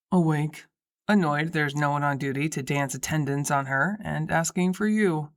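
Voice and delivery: low voice for Drew; male character voice. A narrator's reading. Drew reports on Martha.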